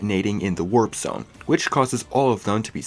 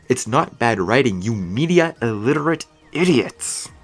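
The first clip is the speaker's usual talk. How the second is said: exasperated jab; 3 semitones higher, 4 dB louder than usual. Real, not acted. Ranting at critics.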